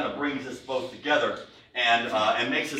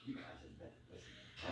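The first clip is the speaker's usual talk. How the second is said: conspiratorial whisper; 7 semitones lower, 24 dB quieter than usual. Retold or imitated. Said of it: "I come up to the Irish people and go".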